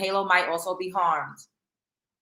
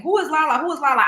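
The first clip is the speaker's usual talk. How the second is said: curious crowd; 6 semitones higher, 4 dB louder than usual. Retold or imitated. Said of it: "everybody's saying".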